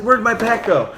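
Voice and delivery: high, agitated retold question; urgent demand. Heat-exhausted marine hunting for his pack, high urgent demand. Confused search for missing pack.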